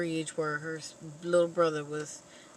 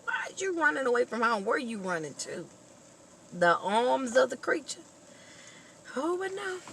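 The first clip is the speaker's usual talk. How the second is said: scolding; 7 semitones higher than usual. Pretend. Scolding the girl character.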